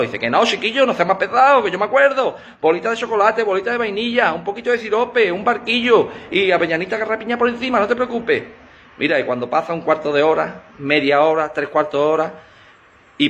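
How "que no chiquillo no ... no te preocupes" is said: exasperated wife. Acted. Exasperated wife repeats order.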